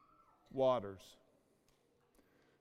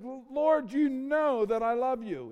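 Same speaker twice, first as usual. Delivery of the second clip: higher, pleading protest of love; earnest Peter; 7 semitones higher, 4 dB louder than usual. Retold or imitated. Peter insists to the Lord that he loves him, raised and pleading.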